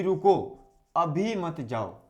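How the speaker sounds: रुको set apart, अभी मत जाओ slow as one phrase; pause after रुको. Shown as a demonstration. Teacher explaining the comma's meaning, pausing after रुको. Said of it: "इसका अर्थ हुआ कि".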